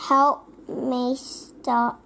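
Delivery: plain reading voice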